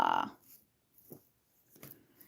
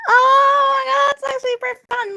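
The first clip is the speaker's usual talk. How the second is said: delighted cry; 8 semitones higher, faster than usual. Real, not acted. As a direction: cry it out, thrilled. Delighted reaction to the art.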